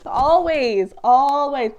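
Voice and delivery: ALWAYS drawn out loud, twice; exasperated emphasis. Real, not acted. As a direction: hit each "always" hard and let it fall. Exasperated rant about open mouth.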